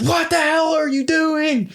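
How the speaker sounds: high, slow, drawn-out mock outrage; mom yelling. Mom shouting at the kids fighting in the car seat. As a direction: play the shocked mom finding the kids fighting. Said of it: "She goes".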